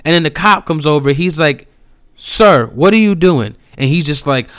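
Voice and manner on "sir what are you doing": higher, louder challenge, lightly acted; retold stern cop. Cop stopping the driver who follows me, mock stern. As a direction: imitate a cop calling a man out.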